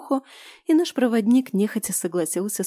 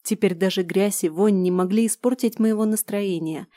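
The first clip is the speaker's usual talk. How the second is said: ordinary delivery, nothing marked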